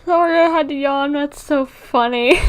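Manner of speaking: normal talking voice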